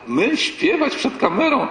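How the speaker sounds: mock disbelieving teenager; mock shocked girl. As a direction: act appalled at the very idea. Girls mocking singing on camera.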